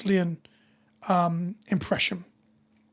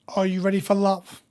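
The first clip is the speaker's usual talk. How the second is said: normal talking voice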